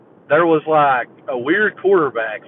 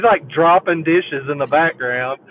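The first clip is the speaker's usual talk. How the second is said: usual speaking voice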